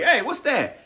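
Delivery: high, startled "what's that?"; a student suddenly spots trig in the algebra; mock surprised student. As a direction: play the confused student caught off guard. Student voiced meeting sudden trig.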